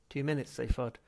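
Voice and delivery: ordinary delivery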